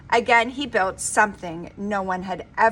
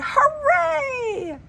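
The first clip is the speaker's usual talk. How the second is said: high, loud crowd cheer; joyful cheer; cheering hooray; 5 dB louder than usual. A narrator's reading.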